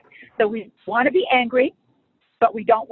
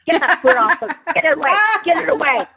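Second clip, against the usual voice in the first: higher, louder, fast shooing; mock irritation; 5 semitones higher, 3 dB louder, faster than usual. Waking angry and pushing off the spouse's foot, irritated. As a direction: shove the words out like swatting the foot away.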